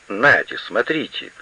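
ordinary delivery

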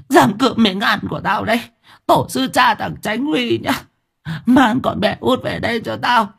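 high, screeching crone curses; screaming hag. Acted. Grandmother stamping and screaming for her granddaughter back. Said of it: "vừa nói vừa la hét".

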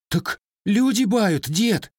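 high, slow mock-frightened plea; frightened whimper. A narrator's reading. Grandson pleads with his angry grandfather, frightened. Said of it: "испуганно всхлипнул паренёк".

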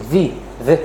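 في with a buzzing English v; voiced lip-teeth sound opening each في. Shown as a demonstration. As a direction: say في as "vee, veh". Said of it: "مش، مش اف".

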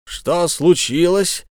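stern, slow question. A narrator's reading. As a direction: ask it sternly, frowning. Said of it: "сурово насупившись".

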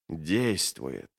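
low, soft exhale of satisfaction; hushed, slow-dawning satisfaction; breathed out. A narrator's reading. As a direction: let the word out on a breath. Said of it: "выдохнул он".

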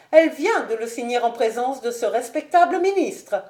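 high-pitched, emphatic noblewoman; imperious mother. Acted. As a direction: answer for the daughter with crushing authority. Lady Ashton answers for her daughter. (mère impérieuse, voix haute et ferme)

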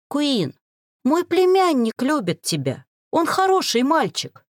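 high, insistent pleading; fervent, high-pitched defence; exclaimed plea. A narrator's reading. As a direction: exclaim it to win them over. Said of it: "воскликнула предательница".

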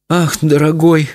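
high, slow, sighed lament; sighing. A narrator's reading. Wife to husband after fainting, sighing. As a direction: sigh it out slowly and high. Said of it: "вздохнула она".

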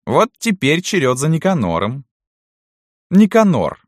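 high, loud summons from the lady; imperious mistress. A narrator's reading. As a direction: call the servant to account. (властная барыня, громко и высоко)

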